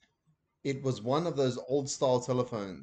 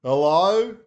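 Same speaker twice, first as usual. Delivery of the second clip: loud, higher Hello over the noise; shouting; 5 semitones higher, 13 dB louder than usual. A narrator's reading. Dad shouting down the phone over the show. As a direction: yell it down the line. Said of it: "shouted Dad".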